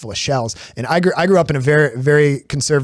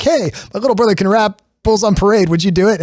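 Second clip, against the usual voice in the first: higher, eager call to show someone off; 7 semitones higher, 4 dB louder than usual. Retold or imitated. Older brother showing off his little brother's rapping, eager. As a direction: imitate a boastful big brother, pitched up.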